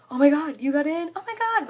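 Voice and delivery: high, loud mock yell; imitating thrilled shouts; retold excited yelling. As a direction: yell it like friends across a room. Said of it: "yelling across the cafeteria".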